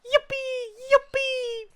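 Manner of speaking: very high, loud squeaky cheer; mock glee; squeaky yippee. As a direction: squeal it out like a cartoon critter. Squeaky celebration.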